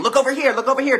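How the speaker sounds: high, loud come-look call; ego calling out. Acted. The ego distracting us from the helpful voice, loud and high. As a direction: pull attention away, loud and high.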